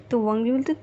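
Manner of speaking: usual speaking voice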